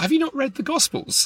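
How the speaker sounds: high, incredulous "have you not read"; incredulous question. Retold or imitated. Friend's incredulous question quoted.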